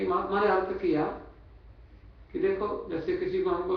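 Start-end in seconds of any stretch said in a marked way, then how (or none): none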